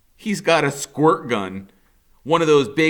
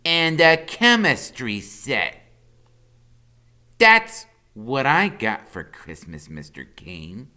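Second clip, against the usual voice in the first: put-on slow taunting kid; 2 semitones higher, 5 dB louder, slower than usual. A narrator's reading. Freak taunts slowly.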